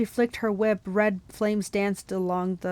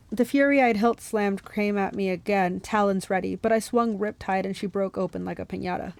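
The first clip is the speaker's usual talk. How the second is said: plain reading voice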